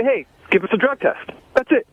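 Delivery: usual speaking voice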